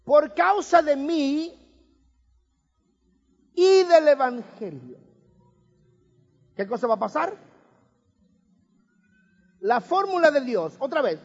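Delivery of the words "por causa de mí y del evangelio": por causa de mí y del evangelio drawn out slowly, words spaced and leaned on; slow emphatic quote. Shown as a demonstration. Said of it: "y ahí viene la fórmula".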